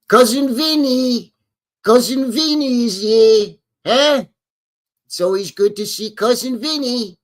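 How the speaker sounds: mobster voice. Acted. Streamer greets Cousin Vinny as a wiseguy, in a hammed-up mobster voice. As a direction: do a Brooklyn wiseguy welcoming his cousin.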